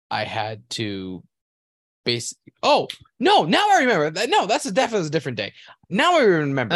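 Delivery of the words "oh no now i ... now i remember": much higher and louder, excited; sudden realization. Real, not acted. Interrupting his own story as it comes back, excited. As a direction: blurt it out as the memory hits.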